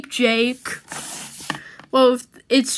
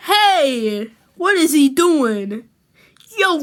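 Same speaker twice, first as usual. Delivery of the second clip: usual speaking voice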